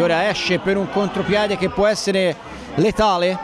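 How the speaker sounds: rising play-by-play on the counter; breathless italian goal commentary. Acted. Equaliser call commentary.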